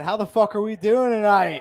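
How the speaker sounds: rally the room, hit doing hard. Real, not acted. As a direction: comedian demands a bigger cheer from the crowd, loud. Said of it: "I think you guys can do a little better than that".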